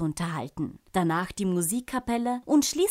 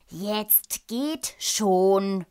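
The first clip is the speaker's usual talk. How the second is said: slow, impatient royal command; king calling out; 4 dB louder, much slower than usual. A narrator's reading. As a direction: shoo them off with drawn-out words. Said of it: "rief der König".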